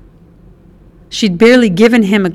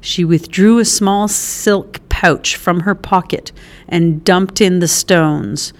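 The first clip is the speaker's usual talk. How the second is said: ordinary delivery, nothing marked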